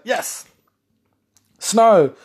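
high, joyful cheer at the snow; excited cheer. Retold or imitated. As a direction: cheer like a kid seeing snow. Excited about snow, jumping up.

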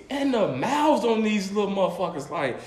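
ordinary talk, nothing marked

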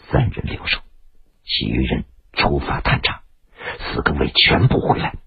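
hushed, low order in the dark; quiet command. A narrator's reading. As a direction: give the order under your breath. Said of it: "邓玲子轻声地命令着".

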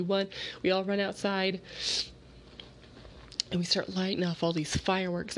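ordinary talk, nothing marked

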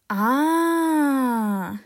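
drawn-out high "aaah"; long "ah". Real, not acted. Podcaster remembering a teacher called Bergen, long drawn-out "ah".

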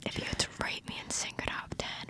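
soft whispered welcome; ASMR whisper. Pretend. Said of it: "let's do some A S M R".

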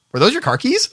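high, innocent question; lightly voiced sheepish guy; sheepish customer. Customer asking the pumper about the keys, high sheepish. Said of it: "he goes".

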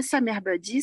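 "Sa mère badie" pronounced as French for "summer body"; French-style mispronunciation. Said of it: "eles só falavam toda hora".

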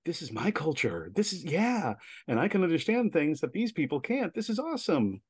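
delighted realization. Retold or imitated. Audience member hearing their own language onstage, delighted. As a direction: pitch climbing into thrilled disbelief. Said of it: "sort of face lighting up of".

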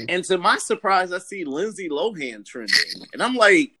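normal talking voice

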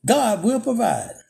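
very high, slow Praise the Lord; church lady's praise. Retold or imitated. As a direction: sing it out high like the old lady. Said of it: "then says".

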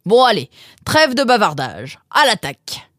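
high, loud playful charge; hearty mock battle cry; rallying father. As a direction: cut the chat and rally them to eat. Father launches into the meal.

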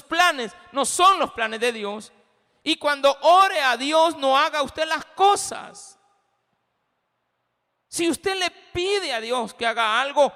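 loud, high sermon delivery, slowing on the warnings; preacher driving a point home to the church; full-force preaching. Acted. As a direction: preach it out to the congregation.

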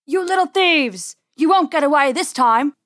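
loud, high angry farmer shout; wild yelling. A narrator's reading. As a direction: bellow at the little thieves. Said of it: "yelling wildly".

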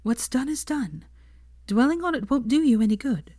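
lightly voiced comforting girlfriend; Hermione's voice. A narrator's reading.

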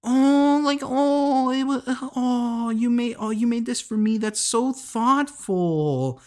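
high, breathy mock swoon; mock gushing girl. Podcaster mocking Tia gushing over a cheap gift, high fake swoon. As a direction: gush like a smitten girl, way up high. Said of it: "this was like".

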